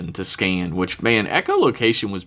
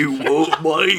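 put-on Hobbit troll's English accent, rising at the end; bad English troll, half laughing; 7 semitones higher than usual. Showing co-host the Hobbit troll, hammed-up English accent. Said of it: "English accent is trolls from The Hobbit".

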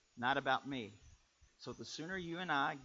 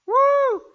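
high, loud preacher's woo; excited whoop; 21 dB louder than usual. Real, not acted. Preacher firing up the congregation mid-story, excited whoop. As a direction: whoop it out at full force.

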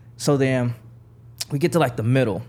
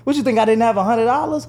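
high, loud indignant question; insulted that she doubted him; very high and loud offended comeback; offended retort; 10 semitones higher, 5 dB louder than usual. Retold or imitated. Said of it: "He was like".

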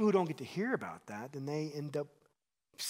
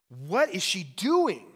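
loud, drawn-out outraged question; indignant; 4 semitones higher, 8 dB louder, much slower than usual. Acted. Preacher showing the congregation what indignantly means, indignant. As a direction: act mad, pitched up and stretched out. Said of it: "what is indignantly? It's like you're, like, mad".

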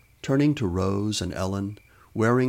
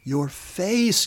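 high, gleeful teasing on a rising pitch; giggly; 10 semitones higher than usual. A narrator's reading. Ellen teases her friend after the prank, giggly. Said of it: "giggled Ellen".

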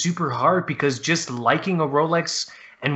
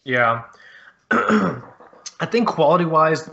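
ordinary talk

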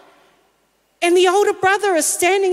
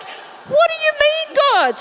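mock surprise; 13 semitones higher than usual. Reacting to God's answer.

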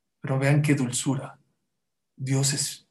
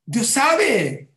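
emphatic cry; 8 semitones higher, 5 dB louder than usual. Real, not acted. Preacher's emphatic outburst.

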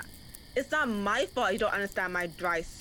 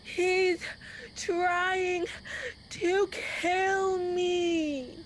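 high, slow, drawn-out wail; faking fear for the officers; fake terror; 8 semitones higher, much slower than usual. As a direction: overplay the victim. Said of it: "your brilliant acting".

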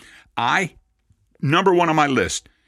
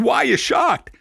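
much higher, puzzled why; puzzled question; 12 semitones higher than usual. Retold or imitated. Rabbi retelling his own puzzled question to Cahn, much higher. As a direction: ask it bewildered.

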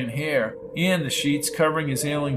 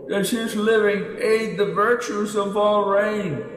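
ancient elder; 6 semitones higher, slower than usual. A narrator's reading. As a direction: intone like an ancient sage. Elder speaks.

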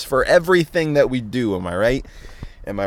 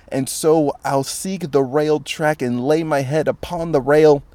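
higher, hammed-up recitation; theatrical poem reading; 4 semitones higher than usual. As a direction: ham up the dark verse.

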